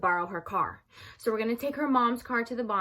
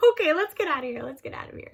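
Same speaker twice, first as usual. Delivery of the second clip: hurried getaway; 6 semitones higher, faster than usual. Retold or imitated. Hurried getaway, ending in hush.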